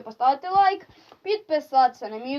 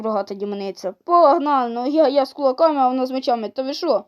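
ordinary delivery

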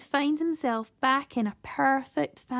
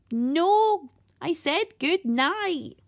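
louder, slow, higher shout; 5 semitones higher, 3 dB louder, much slower than usual. A narrator's reading. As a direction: say it louder so she hears. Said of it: "No need to shout, dear".

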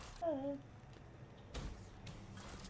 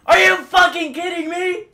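furious shout; 14 dB louder than usual. Real, not acted. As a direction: scream it at the screen, way up high. Gamer fails again.

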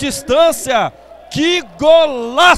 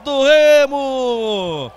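goal call; slower than usual. Real, not acted. Commentator calls a goal.

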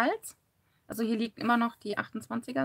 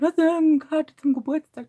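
quoting husband; 8 semitones higher, 10 dB louder than usual. Retold or imitated. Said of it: "Wenn mein Mann runterkommt".

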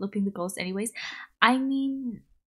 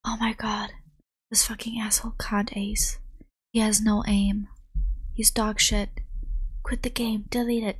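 whispered gamer trash talk; mock ASMR whisper. Imagining Valorant played as ASMR, mock whispered rant. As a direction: play at raging in an ASMR whisper. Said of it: "Valorant A S M R".

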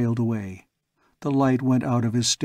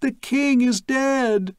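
much higher, louder, slow scream; girl screaming; 12 semitones higher, 3 dB louder, slower than usual. Acted. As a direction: scream it out in horror. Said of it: "we heard it again".

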